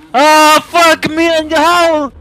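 loud, slow scared swearing; frightened shout. Real, not acted. Jump scare while playing.

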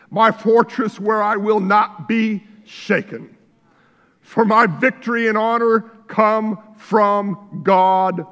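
preacher reading a psalm aloud with fervour; fervent scripture reading. Acted. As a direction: proclaim the verse with conviction.

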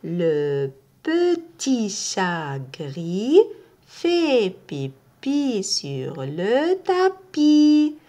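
"Le petit chat gris fait pipi sur le tapis" slowly, tapis stretched; slow repeat of the sentence. Shown as a demonstration. Teacher repeats the phrase for learners to copy. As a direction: read it again, slowly and clearly. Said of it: "Je répète la phrase".

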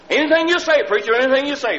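high, loud and keen; retelling the man's excited yes-sir; eager agreement. Retold or imitated. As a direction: promise gladly to do anything. Man eagerly agrees to wait.

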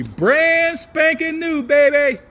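high, slow, hyped-up promise. Real, not acted. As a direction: sell it big, drawn out. Hyping new movies.

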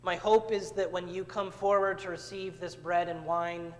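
ordinary talk

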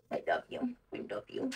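soft low crooning; dog talk. Real, not acted. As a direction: murmur love to the dog. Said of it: "You talk like this to your cat".

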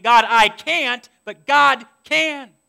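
loud, slow, drawn-out declaration; preaching emphasis. Real, not acted. Preacher proclaiming to the congregation that God can, loud and slow. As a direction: proclaim it slowly and loudly. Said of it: "I complained".

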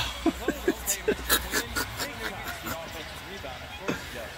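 long, trailing chuckle; real laugh. Real, not acted. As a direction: laugh it out softly, letting it run. Laughs at Curry scoring freely.

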